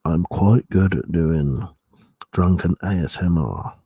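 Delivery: put-on very low, close-up drunken ASMR voice.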